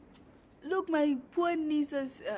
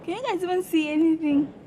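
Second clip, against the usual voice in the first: loud, raised voice over the wind; shouting over wind; 3 semitones higher, 10 dB louder than usual. Real, not acted. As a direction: call out over the gusts. Filming outside in strong wind.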